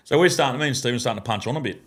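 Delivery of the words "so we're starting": ordinary talk, nothing marked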